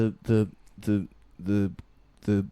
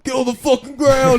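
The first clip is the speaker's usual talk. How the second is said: very high, loud barked Fred; cartoon leader shouting an order; 13 dB louder than usual. Shown as a demonstration. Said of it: "do your best Fred".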